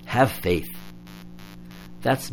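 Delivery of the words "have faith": "Have faith" dropped low and flat; dismissive repeat. Shown as a demonstration. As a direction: repeat it to show it's empty law. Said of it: "that's not the gospel".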